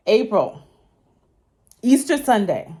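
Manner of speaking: ordinary delivery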